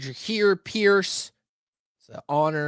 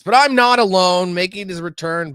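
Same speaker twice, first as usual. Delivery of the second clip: hype intro; 6 semitones higher, 8 dB louder than usual. Acted. Host introducing guest dramatically.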